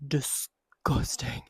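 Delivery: slow whispered disgust. Real, not acted. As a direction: drag it out, disgusted. Complaining about the heat.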